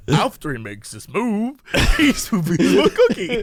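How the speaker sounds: a hint of creepy narrator, breaking into laughter; mock creepy narrator. Teasing co-host's narrator impression, mock creepy. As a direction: mimic the weird narration, amused. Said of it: "The way you said it was like".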